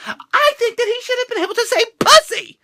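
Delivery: high-pitched mock complaint; high mock protest. Real, not acted. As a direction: jump up high, playful indignation. Mock outraged high aside.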